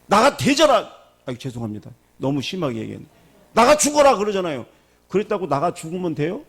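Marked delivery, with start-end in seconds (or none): 0.0-0.9 high, loud furious yell; angry parent yell. Retold or imitated. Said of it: "화나서 뭐라 그래?". 3.5-4.2 very high, loud outburst; angry parent yell. Retold or imitated. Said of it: "화나서 뭐라 그래?".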